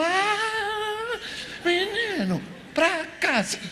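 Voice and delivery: put-on high, sharp mother calling her boy home; a fretful mother snapping at her son to come home; nervous Mary scolding. Mary, anxious after three days of searching, scolds young Jesus in a high voice. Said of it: "mas Maria tá nervosa".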